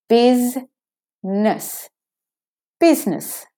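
"Business" slowly as BIZ-nis; two-syllable business. Shown as a demonstration. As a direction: glide from Z to N in "Business". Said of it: "straight from the Z to the N".

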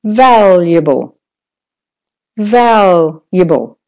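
slow "valuable" with VAL stretched; stress on VAL. Shown as a demonstration. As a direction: hold the val in "valuable", relax the rest. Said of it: "make louder and longer".

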